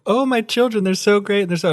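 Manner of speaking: high, gushing proud-parent voice; doting parent. Retold or imitated. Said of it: "We're like".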